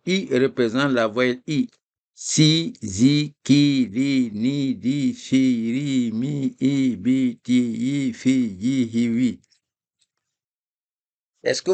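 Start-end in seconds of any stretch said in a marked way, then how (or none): 2.2-9.4 reading each letter with the i sound; teacher modeling si, zi, ki one by one; syllables with i. Shown as a demonstration. Teacher demonstrating the letters read with i for the class. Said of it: "Mettez ça au son I".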